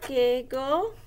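each word dictated slowly with long pauses; slow dictation. Shown as a demonstration. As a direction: spell it out for kids writing along. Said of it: "We're gonna say".

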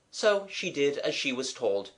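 ordinary delivery

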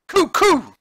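high, loud, indignant cooing; dove having tantrum. Acted. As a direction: coo like a furious dove.

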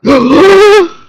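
sudden high, loud gasp-shout with a laugh; startled laughing shriek. Real, not acted. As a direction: yelp in shock, very high and loud. Startled by game.

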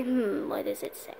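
whispered to himself, unvoiced. Checking the calendar, whispered. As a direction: breathe it out under his breath.

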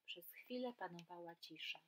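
lively storybook reading with voiced animals; reading to children. A narrator's reading. Teacher reading a story aloud to children, lively and animated. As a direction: read it like a bedtime tale for kids.

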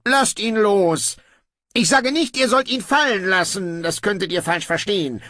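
a lazy king giving in; offhand young king. Acted. Brocando grudgingly orders guards to release his brother, breezy.

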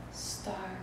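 softly whispered one-word answer. A narrator's reading. Shy girl answers Mama about her name, whispered. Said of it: "Sulwe whispered".

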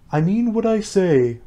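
slow, high, affronted retort; offended. A narrator's reading. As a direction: insist on it, stung and deliberate. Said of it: "in an offended tone".

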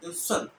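"and son" ending on a stopped n; Fanti stop. Shown as a demonstration. Teacher pointing out the stop, "and son" shut at the end. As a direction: close off the n of "and son". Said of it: "it stops".

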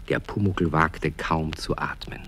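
soft, near-whispered narration; hushed suspense. A narrator's reading. Narrator tells of Pumuckl watching the mouse, hushed. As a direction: narrate as if afraid to breathe.

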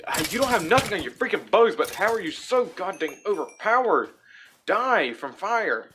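high, loud gamer outburst, slowing at the end; indignant shouting at an overpowered enemy; loud exasperated rant. Real, not acted. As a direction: rant at the game, punching the words. Mock-rants at overpowered game mob.

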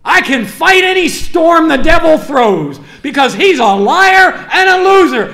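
loud, high, fervent sermon voice; preaching at full force to the congregation; fiery preaching. Acted. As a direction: drive it home to the church.

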